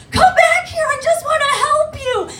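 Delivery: very high, loud squeaky dolphin voice; imagined dolphin. Pretend. Dolphin chasing the comic, calling after her in a high squeal. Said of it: "imagine it saying".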